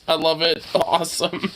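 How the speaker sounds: ordinary talk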